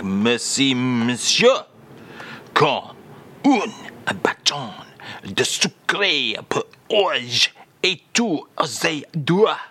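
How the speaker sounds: slow, drawn-out French phrase. Acted. Host asks for the full French phrase, slow French. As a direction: draw out the French, word by word. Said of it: "with your actual French filling".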